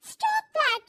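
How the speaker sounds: loud, high snapped command; daughter-in-law angrily shouting at mother-in-law; angry loud command. Acted. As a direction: bark it out in anger. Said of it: "जोर से गुस्से से बोल देती है".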